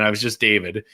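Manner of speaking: usual speaking voice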